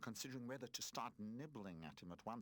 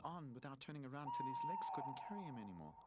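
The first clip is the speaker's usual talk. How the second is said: ordinary delivery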